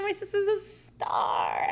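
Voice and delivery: high, slow, soft smiling praise; smiling Anastasia. Retold or imitated. Anastasia on camera praising Bridget, high and smiling. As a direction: say it beaming into the camera. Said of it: "smiling at the camera".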